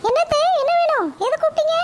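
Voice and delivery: high, sharp-voiced village mother answering her mother-in-law; mother's voice. Mother answers her mother-in-law, high and curt. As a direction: pitch it up as the boy's cranky mother.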